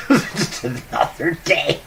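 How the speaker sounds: slow words dissolving into high laughter; laughing. Real, not acted. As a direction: giggle through it with joy. Waking up giggling.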